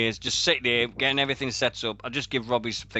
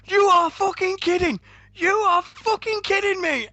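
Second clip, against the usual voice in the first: stunned disbelief; 5 dB louder than usual. Retold or imitated. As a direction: drag out the disbelief, pitched high. Retelling his own stunned reaction.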